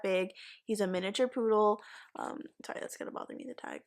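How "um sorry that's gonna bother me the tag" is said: real whispered aside, breath only. Real, not acted. Speaker apologizes to viewers, annoyed by the tag, whispering. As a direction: drop to a whisper, apologizing mid-story.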